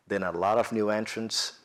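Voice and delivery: ordinary talk, nothing marked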